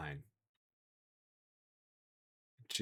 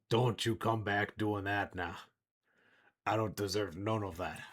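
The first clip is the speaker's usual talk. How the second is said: lightly voiced old man, taking his time; wheezy old man. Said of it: "a wheezy, uh, old man's kind of chuckle".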